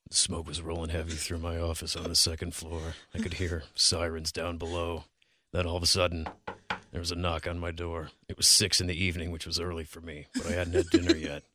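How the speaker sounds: low, hushed hard-boiled narrator; noir detective. Pretend. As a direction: growl it low like a gumshoe. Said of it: "Can you do a noir voice?".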